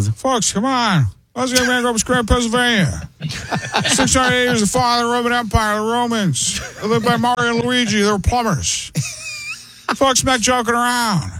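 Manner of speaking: aged Donald Trump. Acted. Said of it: "and now he's, like, almost Biden's age".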